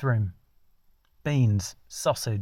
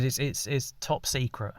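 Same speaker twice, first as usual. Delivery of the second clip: hushed secret; 8 dB quieter than usual. Acted. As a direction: drop to a soft, slow confidential hush.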